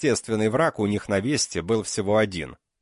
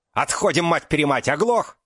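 yelling; 7 semitones higher, 3 dB louder than usual. Acted. Soldier yells at comrade to retreat.

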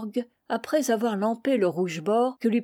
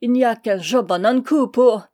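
loud roaring; 3 semitones higher, 7 dB louder than usual. A narrator's reading. Mason boasting through his laughter, loud and gleeful. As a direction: roar it out, delighted.

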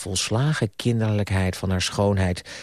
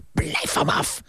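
high, strained outraged cry; mild shout; 9 semitones higher than usual. A narrator's reading. Niece and uncle clashing, strained mild shout. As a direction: cry out in indignation without full volume. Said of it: "schreeuwde hij".

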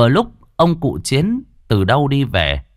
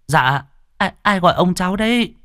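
put-on young girl calling; 9 semitones higher than usual. Girl answering from garden.

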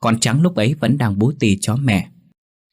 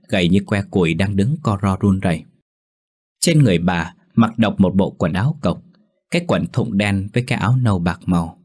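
plain reading voice

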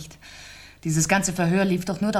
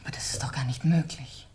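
breathless, horrified whisper; shocked whisper; 6 semitones lower, 8 dB quieter than usual. Acted. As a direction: barely get the words out, stunned. Widow shocked by the news. (entsetztes Flüstern)

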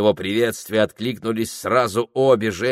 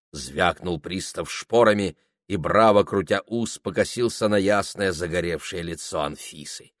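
ordinary delivery

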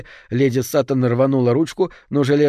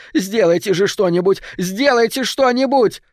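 high, louder panicked cries; frantic shouting; 9 semitones higher, 3 dB louder than usual. Acted. Lady Sutton fights off Bruff, screaming for help. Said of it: "кричала".

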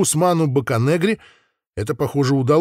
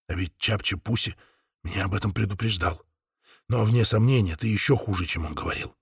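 quiet; 4 semitones lower, 6 dB quieter than usual. Acted. Inca whispers warning.

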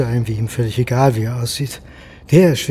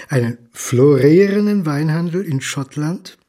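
ordinary talk, nothing marked

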